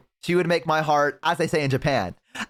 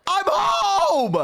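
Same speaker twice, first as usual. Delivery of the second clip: high, drawn-out mock holler; excited Westerner arriving. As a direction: announce yourself like an overeager tourist. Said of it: "That's what every, every, uh, Westerner says".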